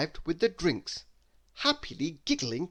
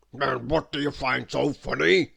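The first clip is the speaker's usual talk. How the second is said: loud, cross gruff voice; loud cross question; crossly; 6 dB louder than usual. A narrator's reading. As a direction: demand it like an offended customer. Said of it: "he asked crossly".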